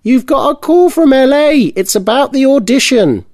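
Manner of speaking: high, loud mother calling out; calling down from balcony. A narrator's reading. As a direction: shout it across from the balcony. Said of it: "her mum shouted from the balcony".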